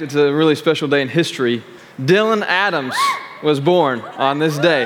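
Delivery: ordinary talk, nothing marked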